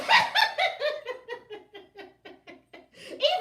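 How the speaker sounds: high, loud burst of real laughter; big laugh. Real, not acted. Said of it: "Y'all got me cracking up here".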